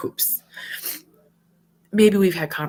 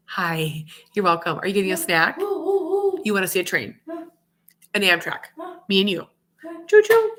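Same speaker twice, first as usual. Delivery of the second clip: bouncy choo-choo voice for her son; 4 semitones higher, 3 dB louder than usual. Real, not acted. Mom talks to young son.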